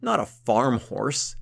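a hint of a scornful count; scoffing disbelief. A narrator's reading. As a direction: drag it out like the idea is absurd. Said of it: "Leodegar laughed in disbelief".

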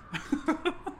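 high, breathy laugh; a quiet airy laugh; a soft laugh as she agrees to a nightcap. A narrator's reading. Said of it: "She laughed again".

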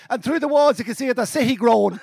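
put-on high, intoned recitation in a Cavan accent. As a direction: recite dramatically in broad Cavan. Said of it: "And then he went".

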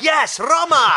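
loud, high mock-triumphant shout; triumphant cheer. Tour guide pumping his fist to tourists, mock triumphant cheer. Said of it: "pump his fist and be like".

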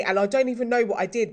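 normal talking voice

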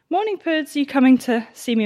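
high, sing-song hello to a pet; pet greeting. Real, not acted. Farm vlogger greeting her dog Puds, sweetly high. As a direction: coo a good morning to the dog.

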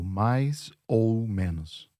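"mais ou menos" slowly, "ou" as a long "oh"; slow, separated "mais, ou, menos"; long clear O. Shown as a demonstration. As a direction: hold "ou" as a round O. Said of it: "make it sound like a O".

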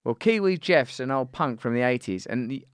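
normal talking voice

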